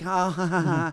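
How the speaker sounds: soft mock "ha ha ha"; softly faked chuckle; imitated polite laugh. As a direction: imitate a small, hollow laugh. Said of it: "they do that like".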